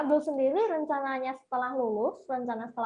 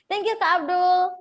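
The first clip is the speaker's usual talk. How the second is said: cheerful thanks; 6 semitones higher, 7 dB louder, slower than usual. Real, not acted. Warmly thanking the guest, closing.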